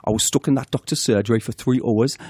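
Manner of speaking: Geordie nan's complaint; broad Geordie accent. Retold or imitated. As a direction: imitate a nan from Newcastle in Geordie accent. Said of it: "and it goes like this".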